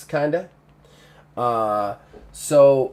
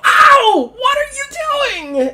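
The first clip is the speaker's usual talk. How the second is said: high, loud pained yelp; NPC woman shouting; 7 dB louder, much slower than usual. Acted. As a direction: yelp in pain then snap at him. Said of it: "You hear her go".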